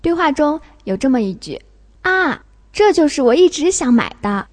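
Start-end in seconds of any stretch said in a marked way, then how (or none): 2.0-4.5 sharp falling "ah" of pleased discovery; surprised. Shown as a demonstration. Teacher showing fourth-tone 啊 from dialogue, surprised. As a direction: exclaim it, higher in pitch. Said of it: "它表示惊讶".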